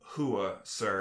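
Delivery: low, softened sassy acknowledgement; sassy military reply. Retold or imitated. Mad recruit to superior, a little sass. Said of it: "I could let a little sass in".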